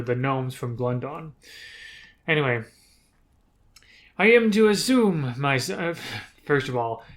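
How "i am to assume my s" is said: put-on higher, louder French-accented matriarch; imperious drow leader with a French accent; cruel French accent. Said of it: "I gave the drow a cruel French accent".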